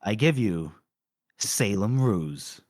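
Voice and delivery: low, slow unveiling of a name; hushed-down presenter's big reveal; mock announcer reveal. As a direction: draw out the name for suspense.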